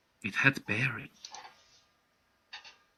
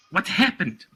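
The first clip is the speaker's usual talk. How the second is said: higher-pitched demand; father demanding an explanation, voice raised in pitch; 7 semitones higher, 3 dB louder than usual. A narrator's reading. Father confronting panting Caruso, demanding. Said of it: "demanded my father".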